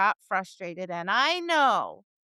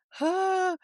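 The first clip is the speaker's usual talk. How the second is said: high, rising puzzled huffs from baffled students; confused students; 9 semitones higher, 4 dB louder, much slower than usual. Retold or imitated. Students baffled at the start of class, high puzzled huffs. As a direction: play a lost student going huh, huh, huh.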